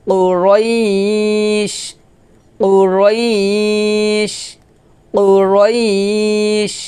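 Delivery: slow tuneful recitation drawing out each word; Quran recitation. Shown as a demonstration. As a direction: chant it slowly with a long held vowel. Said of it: "আমার সাথে বলেন".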